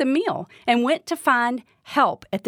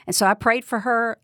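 normal talking voice

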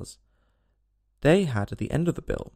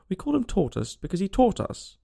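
mildly cross, pitched up; exasperated storybook retort; angry; 7 semitones higher than usual. A narrator's reading. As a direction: snap the explanation with mild irritation. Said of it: "said the mock turtle angrily".

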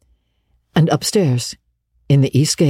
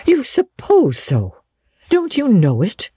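high, slow, incredulous echo; indignant; 9 semitones higher than usual. Acted. Marilla challenging Matthew's lukewarm agreement, indignant. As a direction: throw it back at him, pitched high. Marilla throws his words back.

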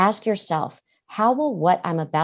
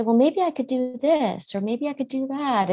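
put-on child thinking aloud; 7 semitones higher than usual. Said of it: "And then they might say".

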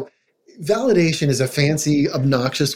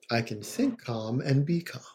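softer calm mantra; 10 dB quieter than usual. Shown as a demonstration. Therapist repeating the mantra for parents, quieter and calm. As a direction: let the mantra sink in, quieter. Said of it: "saying it in a calm, repetitive way".

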